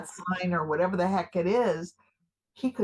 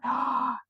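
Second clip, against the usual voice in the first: big excited intake of breath; thrilled gasp; 5 semitones higher than usual. Acted. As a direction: gasp with delight. Thrilled by her own idea.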